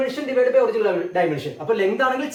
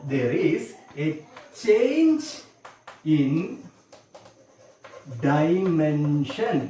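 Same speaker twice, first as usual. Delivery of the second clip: very slow, every word set apart by long pauses; dictating word by word; 4 semitones lower, much slower than usual. Shown as a demonstration. Teacher dictating to the students, each word drawn out slowly. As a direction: spell it out slowly so the class can write it down.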